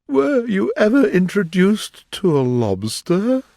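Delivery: lightly voiced mournful turtle; Mock Turtle voice. A narrator's reading. Mock Turtle asking Alice, lightly voiced.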